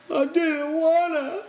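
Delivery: high, slow tearful whine; crying. A narrator's reading. Lenny cries to the men over Curly's hand, tearful. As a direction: cry it out like a guilty child. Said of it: "Lenny cried".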